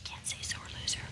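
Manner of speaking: put-on hushed whisper beside the baby. Wife whispering to husband beside baby, hushed. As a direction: whisper so the baby won't hear.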